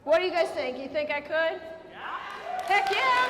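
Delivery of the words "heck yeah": high loud cheer; enthusiastic shout. Real, not acted. Rallying the audience about prisons, excited shout. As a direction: cheer it with the crowd. Answering her own question.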